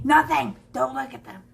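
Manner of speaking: mock defensive. Teacher jokingly defends shadows.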